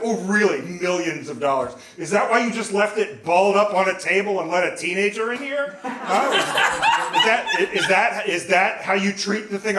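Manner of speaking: loud, high indignant questioning. Retold or imitated. Busted teenager arguing back at the nurse, indignant. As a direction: fire back questions, acting deeply offended. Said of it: "I was so indignant".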